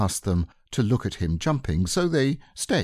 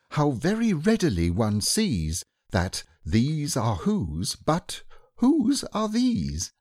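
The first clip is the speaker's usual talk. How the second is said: poem recital; 5 semitones higher than usual. A narrator's reading. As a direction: bear reciting his poem.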